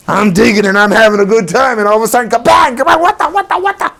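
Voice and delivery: high, loud, rattled dog voice; dog confused by a sudden bang; startled dog. Retold or imitated. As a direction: play the dog jolted mid-dig. Dog startled by thrown can.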